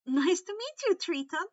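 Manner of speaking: loud, high and beaming with delight; bright and eager. Acted. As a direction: greet him like a new best friend. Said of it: "Percy smiled brilliantly".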